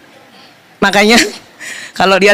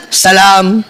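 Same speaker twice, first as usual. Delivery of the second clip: much lower, flatly delivered salam; unenthusiastic husband; 9 semitones lower than usual. Retold or imitated. Husband offering his hand to wife, mock unenthusiastic. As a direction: drop low for an imitated indifferent greeting.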